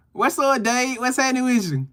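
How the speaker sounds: normal talking voice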